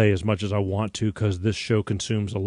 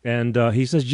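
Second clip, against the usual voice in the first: ordinary talk, nothing marked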